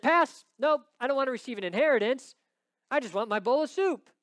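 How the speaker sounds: loud, high, careless brush-off; dismissive Esau. Retold or imitated. Esau turning down his inheritance for soup, loud and dismissive. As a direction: wave it away like it's nothing. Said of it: "Esau said".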